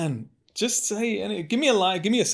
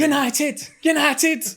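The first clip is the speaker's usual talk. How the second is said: high, louder United chant in Manc; Manchester accent; 14 semitones higher, 5 dB louder than usual. Shown as a demonstration. Guest does Manchester United fan. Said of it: "Take us to Manchester".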